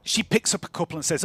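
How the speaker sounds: ordinary talk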